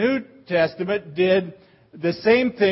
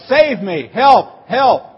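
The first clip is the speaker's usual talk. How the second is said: higher and loud, help yelled twice; mock cry for help; 4 semitones higher, 7 dB louder, slower than usual. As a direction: call out like you're going under. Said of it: "You know".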